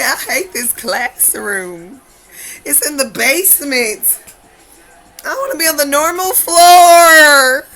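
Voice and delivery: high, drawn-out wail building to a yell; hammed-up childish outburst, loud and slow; mock tantrum. Child hating the basement classroom, wailing mock tantrum.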